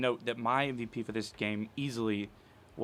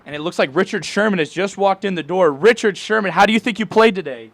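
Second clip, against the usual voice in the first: loud, higher hammed-up sideline reporter; 6 semitones higher, 10 dB louder than usual. Host pretending to interview Richard Sherman, as a hammed-up reporter. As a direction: pitch it like an excited TV reporter.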